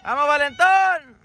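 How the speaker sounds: high, slow drawn-out cheer; cheering shout. Real, not acted. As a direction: urge the player on from the sideline. Cheering on a player. (grito de ánimo)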